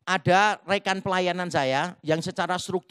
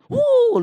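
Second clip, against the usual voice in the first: high, loud "wuh" of amazement; a sudden high-pitched whoop; excited whoop; 8 dB louder than usual. Acted. Mimicked marvel at God's grace.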